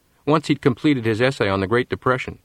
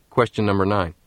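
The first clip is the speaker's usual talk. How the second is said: ordinary delivery, nothing marked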